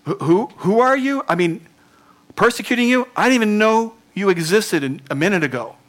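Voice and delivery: high, loud flustered protest; baffled and defensive; flustered Saul. Pretend. Saul protesting to the Lord, flustered and high. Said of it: "And he's saying".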